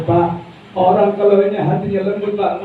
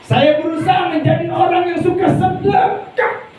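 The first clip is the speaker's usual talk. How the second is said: fiery preaching; 13 semitones higher than usual. Acted. Preacher shouting the vow.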